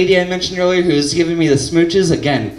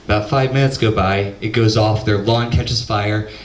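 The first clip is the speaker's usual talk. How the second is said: normal talking voice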